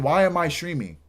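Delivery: usual speaking voice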